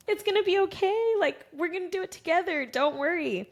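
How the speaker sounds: high, soothing husband voice; a hint of calming her down; lightly voiced reassuring husband. Husband reassuring his scared wife, gently.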